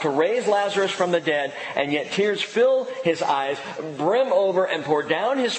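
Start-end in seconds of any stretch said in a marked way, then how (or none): none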